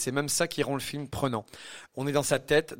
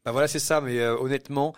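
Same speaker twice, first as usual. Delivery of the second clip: usual speaking voice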